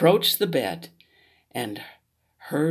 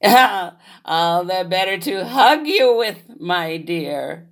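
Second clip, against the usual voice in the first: wolf as grandma; 3 semitones higher, 6 dB louder, slower than usual. Acted. Wolf answers.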